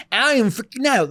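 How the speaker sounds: a hammy British impression of a pop star; freaking out in a fake British accent; bad British accent. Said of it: "the worst British accent I've ever done".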